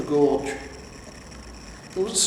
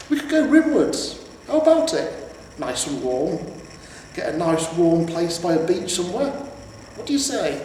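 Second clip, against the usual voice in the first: hopeful horse pitching a retirement plan; coaxing talking horse; 3 semitones higher than usual. Acted. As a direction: wheedle him toward a warm beach.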